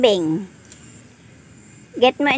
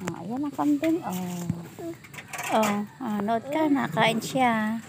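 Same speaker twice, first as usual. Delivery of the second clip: hushed; 3 semitones lower, 14 dB quieter than usual. Real, not acted. Speaking near the goats.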